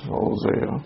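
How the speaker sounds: under-the-breath whisper, counting off names; whispered to self. Real, not acted. Teacher leafing to Hosea, whispering book names. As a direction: whisper the list to yourself as you search. Muttering books while finding verse.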